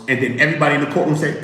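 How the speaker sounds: ordinary delivery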